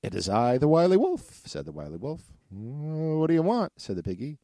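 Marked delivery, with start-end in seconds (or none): 0.0-1.4 high, loud sly wolf. Acted. Wolf at the door. 2.5-3.7 high, loud piggy voice. Acted. Piggy answers wolf.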